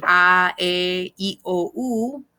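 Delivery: A, E, I, O, U as pure Spanish ah, eh, ee, oh, oo; "A, E, I, O, U" chanted out as Spanish vowels. Shown as a demonstration. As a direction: recite A, E, I, O, U with Spanish vowel sounds. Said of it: "pronouncing the vowels just like that".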